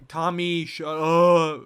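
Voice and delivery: loud, mock-exasperated outburst; fed-up groan. Adult worn down by arguing child Tommy, fed up. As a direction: draw it out, at wit's end.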